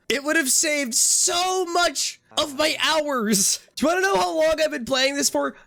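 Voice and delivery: high, exasperated complaining; exasperated rant. Real, not acted. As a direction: vent the frustration, pitch rising.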